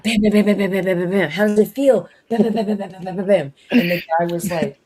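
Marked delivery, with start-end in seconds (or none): 0.0-3.5 punchy repeated bam bam; mock headbutt bams. Retelling the friend headbutting the guy, punchy bams. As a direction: beat out each bam like blows.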